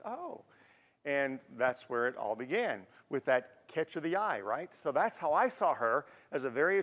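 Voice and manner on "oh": soft, high "oh" of realisation; smitten surprise. Retold or imitated. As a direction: let a hushed, high "oh" slip out. Retold reaction on first noticing her.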